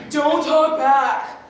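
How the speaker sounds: slow, loud scolding order; mock stern adult. Kid mimicking a parent scolding, slow loud order. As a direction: bark it like a strict parent. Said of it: "They say".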